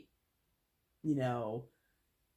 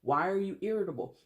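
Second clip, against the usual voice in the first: ordinary talk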